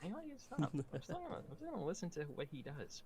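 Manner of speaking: very high put-on fan voice; loyal fan. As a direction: imitate a bemused but loyal admirer, high-pitched. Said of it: "they meet me, like".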